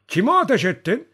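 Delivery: high, loud startled demand; urgent question. A narrator's reading. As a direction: shout the question in alarm. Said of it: "diye sordu".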